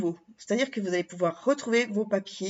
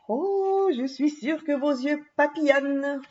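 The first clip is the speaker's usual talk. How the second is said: delighted; 5 semitones higher than usual. Real, not acted. Admiring the paper designs.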